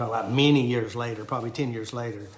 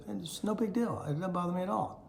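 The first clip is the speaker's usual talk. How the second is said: higher, lightly voiced child brushing it off; nonchalant little daughter; 7 semitones higher, 4 dB quieter than usual. Daughter answering dad while doing dishes, imitated nonchalance. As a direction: imitate a daughter shrugging it off, pitched up.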